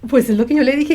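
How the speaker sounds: ordinary talk, nothing marked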